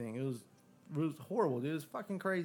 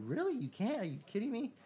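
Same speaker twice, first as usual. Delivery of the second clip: much higher, retelling his disbelief to the cop; incredulous; 9 semitones higher than usual. Retold or imitated. Telling the cop off in the retold story, incredulous and high. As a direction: pitch shooting up in outraged disbelief. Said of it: "I'm like".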